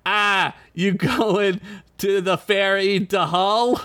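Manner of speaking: put-on lightly done Boston accent; Boston guy. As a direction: drawl it like a Boston local. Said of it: "This man comes up to me and says".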